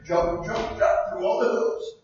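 usual speaking voice

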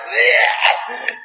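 high, sulky drawn-out yeah; bratty eye-roll yeah. Retold or imitated. Preacher imitating a defiant child apologizing, high and sulky. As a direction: sneer it like a rebelling kid. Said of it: "They have an attitude".

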